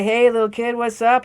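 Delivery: loud, high, hearty greeting; Emperor greeting a kid. Acted. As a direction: call out brightly to a little kid. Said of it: "he say".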